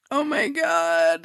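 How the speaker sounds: high slow tearful gasp; mock crying. Moved gamer on the floor, slow high teary gasp. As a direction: let the awe break into a slow high cry. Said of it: "I start crying 'cause I'm just like".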